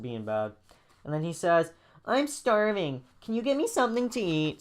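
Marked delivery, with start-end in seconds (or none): 2.0-4.6 put-on high, whiny kid voice; whining. Joey begging Jaden for food, whining. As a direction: whine it high like a hungry child. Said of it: "he's, like, whining right now".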